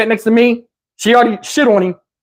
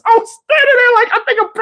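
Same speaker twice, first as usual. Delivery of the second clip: panicky high voice; 13 semitones higher, faster than usual. Retold or imitated.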